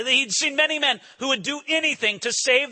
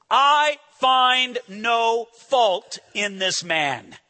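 solemn verdict; much slower than usual. Acted. Pilate's verdict read solemnly.

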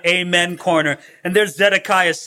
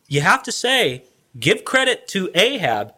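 ordinary delivery

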